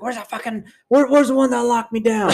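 high, rising to a shout; imitating an angry inmate. Imitating an inmate yelling for the officer who locked her down. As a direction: demand to know who did it.

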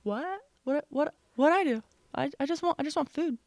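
high, small pretend dog voice; poor confused dog. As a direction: voice an innocent dog, stammering and puzzled. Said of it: "then poor Cooper's like".

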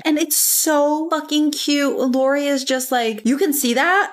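high, gushing over how cute it is. Real, not acted. Cohost gushing to friend about Lori's glasses, high and excited.

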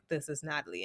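low, hushed, stunned correction; quiet shock. Retold or imitated. Natalie correcting the man who mistook her, quiet shock. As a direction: drop it low and soft, imitating someone stunned. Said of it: "quiet and, like, processing everything".

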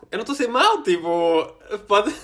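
loud, high defensive protest. Real, not acted. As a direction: protest loudly that you're innocent. Defending himself against accusation.

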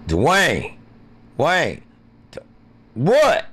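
high loud shouted names; calling out. Pretend. Calling for Dwayne and Wayne, loud and high. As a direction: yell the names across the room.

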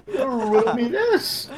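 high, drawn-out villain tease; hammed-up riddler taunt; Riddler catchphrase.

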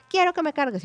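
high-pitched mock toddler demand; child starting to yell. Daughter demanding that mom carry her, imitated high tantrum. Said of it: "empezó a, a gritar".

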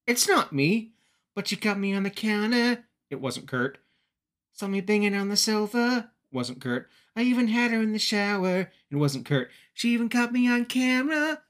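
high, put-on Kurt Angle voice, a bit defensive. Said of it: "Angle walks in and is like".

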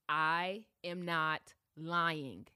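ordinary delivery

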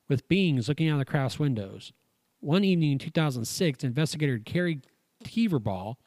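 reading aloud. Acted. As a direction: read the article out like a report. Reads the UFO article aloud.

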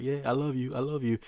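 high-pitched, wheedling declaration of love; mock sweet-talking. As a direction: imitate a man still trying to flirt. Man still trying to flirt.

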